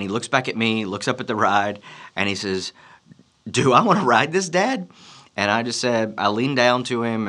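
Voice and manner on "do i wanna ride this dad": imitated little boy, pitch climbing into a question; higher, hesitant mock-child question; unsure child. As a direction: ask Dad doubtfully in a higher kid voice. Son doubts the scary ride.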